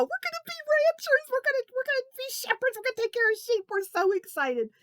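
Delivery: put-on high, eager boyish voices; excited kids. Boys promising their parents, bursting with excitement. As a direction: bubble over about the ranch.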